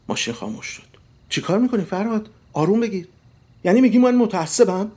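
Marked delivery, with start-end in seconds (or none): 1.3-3.1 high, raised-pitch scolding of a husband; startled, louder outburst; alarmed protest. A narrator's reading. Wife to husband after he slams the brakes, alarmed protest. 3.6-4.9 high, loud affronted question; indignant shout. A narrator's reading. Husband challenging his wife in a car quarrel, indignant shout.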